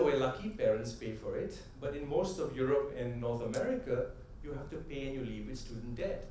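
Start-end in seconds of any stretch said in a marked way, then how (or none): none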